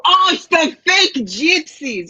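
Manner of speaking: high, slow, sneering mimic; mock their scornful tone. Mocking fellow psychics who sneer at her, high and slow. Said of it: "they will look at me and go".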